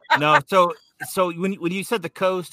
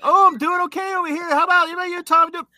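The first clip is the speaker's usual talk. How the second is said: higher, louder hammed-up announcer voice; mock radio host banter; 12 semitones higher, 4 dB louder than usual. Redoing his radio show bit for the hosts, higher and hammed-up. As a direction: ham it up like a cheesy show host. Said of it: "do it like you did on the show".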